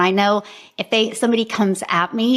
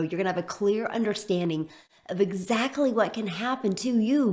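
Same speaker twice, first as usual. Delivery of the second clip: smooth promotional webinar delivery, a little softer; sales pitch read; 9 dB quieter than usual. Acted. Coach pitching her program to prospective buyers, promo read.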